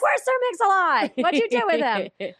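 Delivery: high, loud put-on voice, fast; mock high voice. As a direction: squeal it in a silly voice. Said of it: "I'm like".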